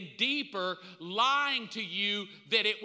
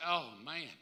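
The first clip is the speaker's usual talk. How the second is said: lower, much softer dazed voice; stoned self; 5 semitones lower, 11 dB quieter than usual. Retold or imitated. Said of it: "My first day on marijuana, I was like".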